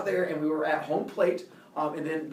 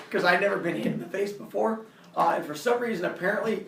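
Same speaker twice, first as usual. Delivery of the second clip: usual speaking voice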